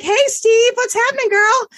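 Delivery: high, sing-song pet greeting; cooing at a bunny. Retold or imitated. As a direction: coo at a little pet bunny.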